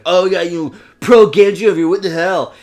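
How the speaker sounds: scornful taunt; being a dick. Retold or imitated. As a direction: imitate an obnoxious gamer sneering at a teammate. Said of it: "the guy was just being a dick".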